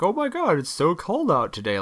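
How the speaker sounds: high mock small-talk gush; small talk with customers. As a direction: coo it at a customer. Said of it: "sitting there like".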